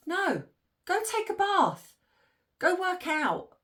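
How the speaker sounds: mock bossy husband. Imitating the husband ordering his wife, mock bossy. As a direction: send her off to the bath, mockingly. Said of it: "Harry walks in and says".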